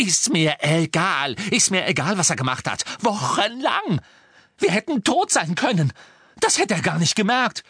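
high, loud, overriding rant; furious outburst. Acted. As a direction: cut him off and rage on. Hermine schreit Harry nieder.

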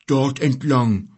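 slow, drawn-out bear reply; grumbled. Acted. Bear showing the boy the way, grumbling. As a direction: point the way with slow, mild grumpiness. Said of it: "brummte er".

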